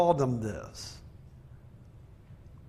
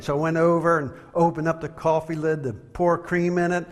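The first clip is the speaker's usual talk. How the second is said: normal talking voice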